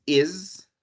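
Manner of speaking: "is" drawn out slowly as iz; teacher modelling the third plural ending; iz ending demo. Shown as a demonstration. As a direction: stretch "is" slowly so the buzz is heard. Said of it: "la tercera pronunciación".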